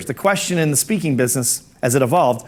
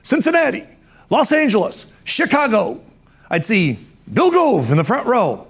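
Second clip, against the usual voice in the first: high-pitched orator's impression, city by city; Doctor McFarland; 8 semitones higher than usual. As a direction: imitate a grand old-school speaker. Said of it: "the way he talked, he'd say".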